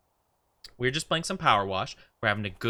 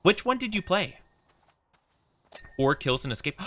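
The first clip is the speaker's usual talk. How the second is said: ordinary delivery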